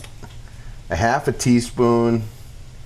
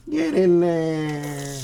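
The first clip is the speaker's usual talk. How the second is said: slow, stretched, higher coaxing; drawn-out cheer; 5 semitones higher, much slower than usual. Acted. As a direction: coax the food into the pan slowly. Tossing food into pan.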